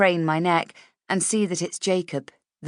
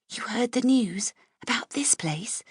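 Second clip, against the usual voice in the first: whisper; 7 semitones higher than usual. A narrator's reading. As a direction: lean in and whisper secretly. Leaning close during meeting.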